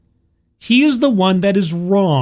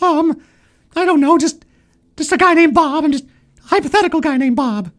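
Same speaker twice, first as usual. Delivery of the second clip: sheepish guy voice; 9 semitones higher than usual. Pretend. Acted skit, Bob answers.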